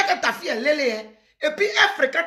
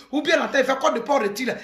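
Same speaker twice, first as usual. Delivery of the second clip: ordinary delivery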